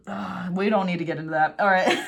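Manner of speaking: ordinary talk, nothing marked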